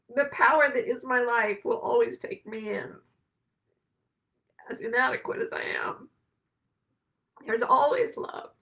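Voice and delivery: real tears held just under the words; holding back tears. Real, not acted. Describing her sanctuary, fighting back real tears. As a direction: voice close to breaking, pushing on. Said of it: "I'm gonna try so hard not to cry".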